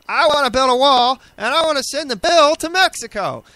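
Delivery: hammed-up, booming campaign boast; horrible Trump. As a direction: blare it out as a badly faked loudmouth politician. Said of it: "that was a horrible Trump".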